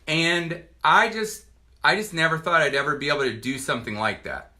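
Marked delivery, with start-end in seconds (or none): none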